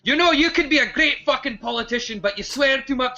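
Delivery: imitating grandfather. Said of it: "my grandfather was just like".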